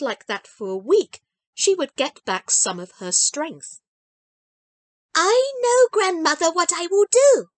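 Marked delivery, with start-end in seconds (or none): 5.1-7.5 high, louder, bursting with an idea; a child who can't wait to share her plan; eager little girl. A narrator's reading. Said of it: "said Heidi eagerly".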